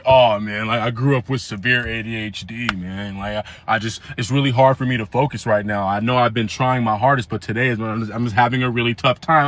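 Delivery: mock self-pity. Friend's pitiful excuse voiced.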